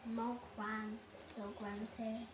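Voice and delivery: ordinary delivery, nothing marked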